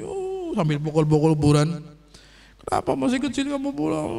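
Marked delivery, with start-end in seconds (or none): 0.0-0.5 high, soft mock sob; high "oh" of mock crying; imitated wailing. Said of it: "ibu ini nangis". 2.7-4.1 high-pitched mock wailing; plaintive, high retold complaint; imitated lament. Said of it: "sambil ngomong ngeluh-ngeluh itu".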